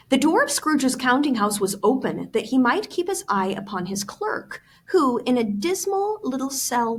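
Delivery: reading the novel aloud to the class; story reading. A narrator's reading. Teacher reading Dickens aloud to students, story voice.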